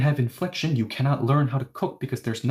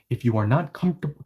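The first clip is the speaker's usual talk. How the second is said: ordinary talk, nothing marked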